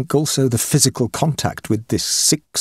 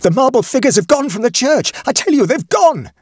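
very high, loud and desperate; terrified man pleading with the doctor; frantic; 4 dB louder than usual. Acted. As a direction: insist wildly that it's true. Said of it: "a little less frantic".

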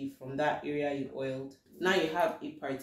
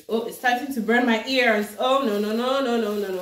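alarmed; 4 semitones higher, 7 dB louder than usual. Real, not acted. Ears begin to burn.

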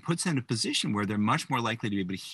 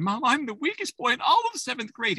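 put-on high, upset young boy voice; 13 semitones higher, 3 dB louder than usual. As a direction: sound like a crushed seventh grader. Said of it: "he's saying".

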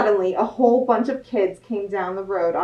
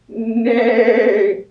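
a louder "Nay" like a horse; horse neigh; 4 dB louder than usual. Acted. Horse answering Duck with a neigh. Animal noises in picture book.

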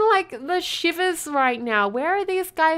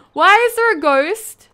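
loud, higher startled question; loud, astonished demand for an explanation; alarmed; 5 semitones higher, 9 dB louder than usual. Real, not acted. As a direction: blurt it out in alarm. Startled by ghost.

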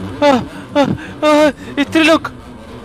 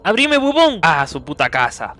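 ordinary delivery

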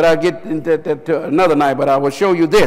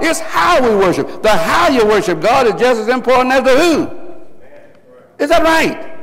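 high, emphatic pulpit cries; full-force preaching; 7 semitones higher, 3 dB louder than usual. Real, not acted. Preacher telling the congregation how to worship, impassioned. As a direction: hammer the point home to the congregation.

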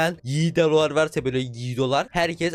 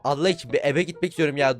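ordinary talk

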